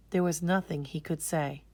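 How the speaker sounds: plain reading voice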